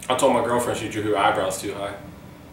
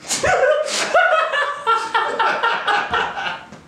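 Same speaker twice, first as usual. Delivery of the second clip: corny dad laugh. Real, not acted. Laughs at watchdog joke.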